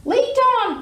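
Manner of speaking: high, loud eager cry; cried. Acted. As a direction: shout it greedily. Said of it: "cried the rat".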